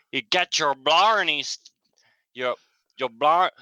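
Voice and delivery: halting, badly faked Scottish accent; attempted Scottish accent. Shown as a demonstration. Showing a co-host the Scottish version, halting Scottish accent. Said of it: "And then Scottish be".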